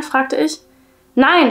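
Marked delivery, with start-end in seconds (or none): 1.1-1.5 lauter, hoher Schrei des Kindes; scream. A narrator's reading. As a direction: ein panisches Nein, laut herausgeschrien. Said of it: "schrie Jessie". (der Junge schreit seine Mutter laut und hoch an)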